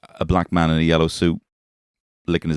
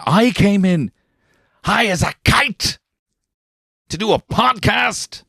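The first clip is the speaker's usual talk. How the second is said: very high, slowed, louder build; mock outrage; 4 dB louder, slower than usual. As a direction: let the voice climb in disbelief. Imagining boss, rising outrage.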